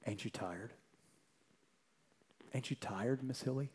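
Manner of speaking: soft and quiet, asking not accusing; gentle, tired plea. Retold or imitated. Maid gently asks her accuser if she's tired, softly. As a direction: lay it down gently, barely above a murmur. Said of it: "this is the gentle response".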